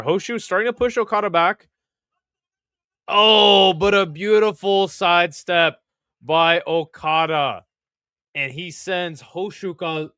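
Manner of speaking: mock sumo commentator calling the bout; play-by-play call. Streamer calling the sumo bout for chat, play-by-play. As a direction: narrate the clash like a live announcer.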